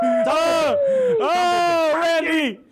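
high, ecstatic hollering; delighted fan shout; jubilant whooping. Real, not acted. Co-host celebrates prank caller.

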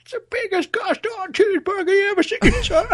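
put-on high old codger voice; hammed-up grandpa brag; mock old man. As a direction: boast it like an old-timer. Mock old man continuing bit.

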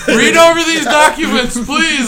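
high laughing mock plea; pleading fan. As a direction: beg him from the sidelines.

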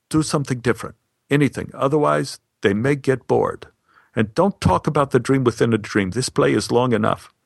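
ordinary delivery

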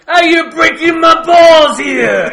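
shouted slow and high in a cartoon Italian accent; quoting a trailer line in a hammed-up Italian accent; mock Italian mobster. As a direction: bellow it like an over-the-top movie Italian American. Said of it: "the part where he says".

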